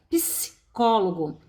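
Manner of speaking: Psicólogo said slowly, syllables apart; slow down on Psicólogo to show the split; slow teacher demo of Psicólogo. Shown as a demonstration. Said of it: "P S, não se separa".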